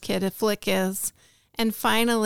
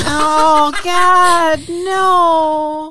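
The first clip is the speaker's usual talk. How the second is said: horrified no, loud and high; loud disgusted protest; 6 semitones higher, 9 dB louder, much slower than usual. Real, not acted. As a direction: shout it out in disgust. Disgusted protest at gross joke.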